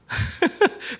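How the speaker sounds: high airy laugh at his own joke; breathless chuckle; burst of laughter. Real, not acted. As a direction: laugh out at the punchline.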